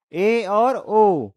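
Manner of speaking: "ए" and "ओ" stretched very slowly, each vowel held; drawn-out vowels. Shown as a demonstration. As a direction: sound out "ए और ओ" at a crawl, half-open mouth. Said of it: "इसमें क्या होगा".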